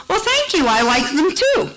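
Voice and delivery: high, chirpy, flattered thanks; a flattered customer answering a compliment; pleased Deb. Retold or imitated. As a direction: play it coy and pleased.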